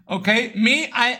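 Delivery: usual speaking voice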